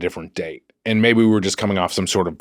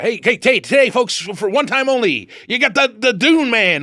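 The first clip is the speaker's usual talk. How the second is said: huckster; 11 semitones higher than usual. Acted. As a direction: hype it like a carnival barker. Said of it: "were you going for huckster?".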